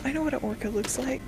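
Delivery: fast whispered grumble. Real, not acted. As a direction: keep it under your breath, quick. Whispered bickering while filming.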